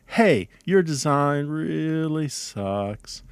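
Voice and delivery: drawn-out, slow, sorry-for-you delivery; sympathetic. Shown as a demonstration. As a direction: stretch it out gently as if pitying a colleague. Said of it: "I feel sorry for you".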